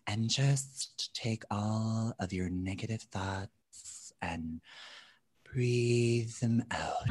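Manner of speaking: very soft, slow, airy guided-meditation delivery; meditation guide voice. Retold or imitated. Yoga teacher guiding the meditation class, very soft and slow. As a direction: lull the class into letting go. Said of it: "and she's like".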